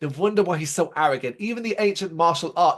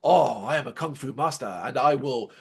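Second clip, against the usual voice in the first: a lightly voiced wise old master, lower; imitating a cartoon kung fu master, a bit lower; lower-pitched hint of a wise martial arts master; mock kung fu master; 6 semitones lower than usual. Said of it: "he talks in all that".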